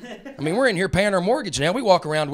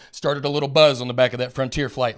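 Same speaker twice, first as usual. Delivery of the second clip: ordinary delivery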